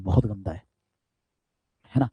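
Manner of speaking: ordinary talk, nothing marked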